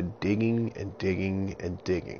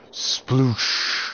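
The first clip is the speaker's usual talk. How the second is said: splash sound; 5 semitones higher, 4 dB louder than usual. A narrator's reading. Splash sound effect in story.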